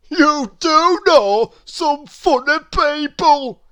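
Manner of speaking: a jolly plump man amused; Mister Greedy. A narrator's reading. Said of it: "said Mister Greedy".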